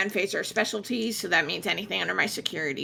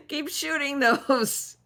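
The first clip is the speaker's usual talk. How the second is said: high, slow call without full volume; half-hearted mock shout; 6 semitones higher, slower than usual. Player repeats character's order to the crew, mock shout. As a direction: pretend to yell, pitched up and stretched out. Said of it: "then he starts shouting".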